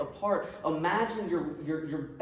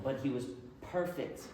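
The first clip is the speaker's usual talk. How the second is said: normal talking voice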